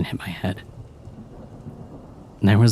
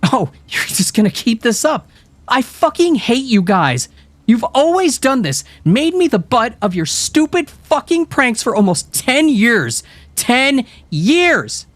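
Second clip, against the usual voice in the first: very high, loud, tearful anger; girl accusing friends of years of pranks, furious; furious rant; 4 dB louder than usual. A narrator's reading. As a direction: spit out years of hurt.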